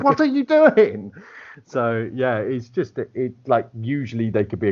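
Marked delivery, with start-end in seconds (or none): 0.0-1.1 high, loud, drawn-out disbelief; mock-aghast outburst; incredulous table reaction. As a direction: exclaim it in high-pitched bafflement. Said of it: "they'd be like".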